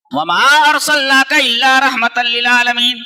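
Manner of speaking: slow melodic recitation; Quran recitation. Acted. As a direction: intone each word of the verse.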